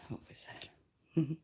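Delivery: slow, whispered aside; faint murmur to herself. Real, not acted. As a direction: whisper it slowly to yourself. Quiet aside while smoking.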